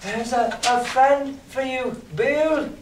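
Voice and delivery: put-on high, halting frightened boy; scared lad's quavering cry; trembling voice. A narrator's reading. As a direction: stammer it high and slow, terrified. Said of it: "in a trembling voice".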